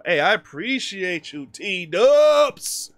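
loud, high, stretched thank-you; hyped shout-out. Real, not acted.

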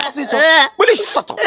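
usual speaking voice

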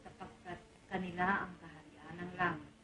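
low, slow, reverent reading of scripture; solemn recitation. Acted. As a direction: recite it like a prayer in church. Recites the Beatitudes.

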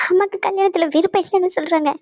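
confessing through tears; tearful. Acted. Bride admitting her mother opposes the wedding, tearful. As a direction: explain while trying not to sob. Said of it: "எதுக்கு அழுதுட்டு இருக்க".

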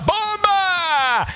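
long, stretched goal cry; excited shout. Real, not acted. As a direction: hold the word like a roar. Goal call, drawn out. (urlo prolungato da gol)

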